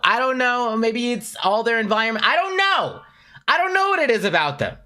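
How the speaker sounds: high-pitched, excited rant; worked-up gushing. Real, not acted. Worked-up gushing about the attraction.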